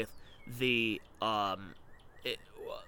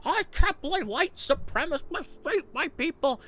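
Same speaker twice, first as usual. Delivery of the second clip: mock angry Warren; 11 semitones higher, 4 dB louder than usual. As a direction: overplay her outrage in a higher voice. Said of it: "she's so angry".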